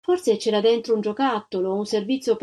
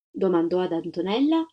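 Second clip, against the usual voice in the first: usual speaking voice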